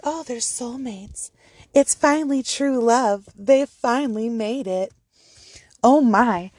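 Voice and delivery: breathy, starry-eyed mock awe over the couple; hammed-up dreamy awe; mock gushing gossip. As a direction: gush like a fan of a celebrity couple. Gossip mocking the perfect couple.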